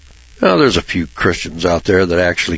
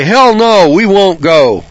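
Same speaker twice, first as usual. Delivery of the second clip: higher, loud defiant no; gruff longshoremen refusal; 10 semitones higher, 6 dB louder, slower than usual. Retold or imitated. Preacher voices longshoremen refusing to go to church. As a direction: bark the refusal like a dockworker. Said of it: "They say".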